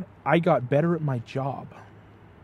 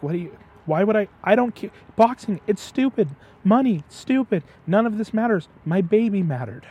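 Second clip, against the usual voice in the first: higher, louder retold outburst of a grieving father; impassioned protest; 7 semitones higher, 6 dB louder than usual. Retelling a father who lost his daughter, impassioned protest. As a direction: throw it all away in short, forceful bursts.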